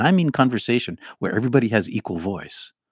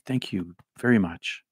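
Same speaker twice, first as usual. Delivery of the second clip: normal talking voice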